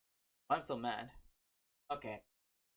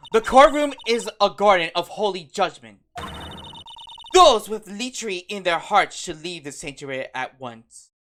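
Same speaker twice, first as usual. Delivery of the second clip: loud, thundering courtroom proclamation; stern judge; 10 dB louder than usual. Acted. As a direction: deliver a booming rebuke from the bench. Acted stern judge orders lechers out.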